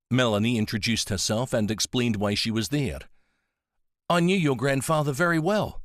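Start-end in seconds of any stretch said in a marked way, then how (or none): 4.1-5.8 put-on higher, friendly old lady with an Antipodean accent; Nancy's voice. A narrator's reading. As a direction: kind elderly woman speaking of an old friend.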